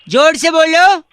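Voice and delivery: chanting caller; loud call. Acted. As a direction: chant it loudly into the phone. Prank caller chanting at uncle.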